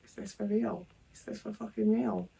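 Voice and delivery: muttered disbelief after a nan tips her dinner; quiet disbelief. Retold or imitated. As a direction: say it under your breath, stunned. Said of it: "I was just like".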